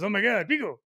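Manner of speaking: high-pitched mock panic; Audrey. Man mimicking Audrey spotting wasps, high mock panic. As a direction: squeal it like a panicking wife. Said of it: "And then one day Audrey goes".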